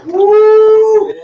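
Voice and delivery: high ringing woo; loud whoop. Real, not acted. Preacher whooping to the congregation. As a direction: let a joyful woo fly.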